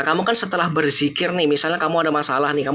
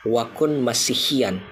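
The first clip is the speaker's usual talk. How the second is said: slow recitation; much slower than usual. Shown as a demonstration.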